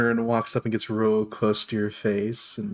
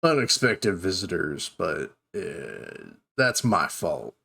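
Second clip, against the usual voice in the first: a slightly lower, sheepish Geralt; apologetic Geralt; 3 semitones lower than usual. Acted. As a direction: shrug off the awkward scene apologetically. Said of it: "And he goes".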